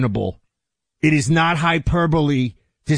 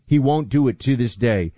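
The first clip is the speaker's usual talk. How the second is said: ordinary talk, nothing marked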